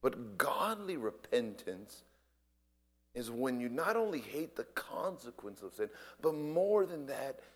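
normal talking voice

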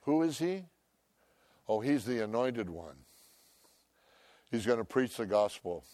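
normal talking voice

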